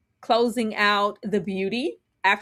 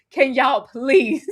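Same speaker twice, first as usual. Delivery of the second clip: begging through giggles; can barely get it out for laughing; laughing plea; 5 semitones higher, slower than usual. Real, not acted. Cohost cracks up, pleading with cohost through laughter. Laughing at a joke during chat.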